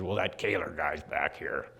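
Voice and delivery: very low, gravelly nurse voice; gravelly old nurse. Retold or imitated. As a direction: sink into a rough old nurse's growl. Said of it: "her voice was like this".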